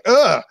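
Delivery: sharp high groan of embarrassment; mock disgusted groan; frustrated ugh. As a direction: blurt out a loud ugh. Frustrated groan in retold scene.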